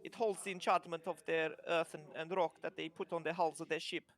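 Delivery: lightly voiced kobold with an Italian lilt; proud little trader showing off his wares; mock Italian kobold merchant boasting of his lumber; kobold's accent. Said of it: "He goes".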